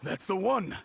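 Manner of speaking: high, excited recognition; delighted, rising exclamation; overjoyed. Acted. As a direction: cry out with relief at seeing it. Owner sees lost ring.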